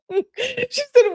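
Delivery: very high, squeaky with laughter; trying to read on through giggles; real laughter. Real, not acted. Reader recovering from laughing at the texts, still giggly. Resumes reading after laughing fit.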